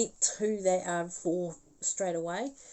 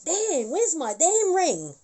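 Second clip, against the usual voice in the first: louder, higher and slow, fed up; exasperated; 10 semitones higher, 8 dB louder, slower than usual. Retold or imitated. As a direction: demand the ring back, irritated. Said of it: "And she's like".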